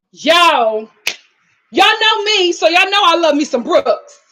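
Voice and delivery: usual speaking voice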